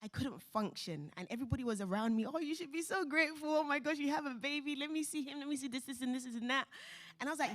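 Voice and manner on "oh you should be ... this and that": high, gushing well-wishers; gushing relatives. Retold or imitated. Mother mimics visitors fussing over her newborn, high and gushing. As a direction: coo over the baby like an excited aunt.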